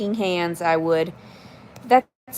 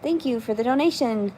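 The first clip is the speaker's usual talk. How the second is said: ordinary talk, nothing marked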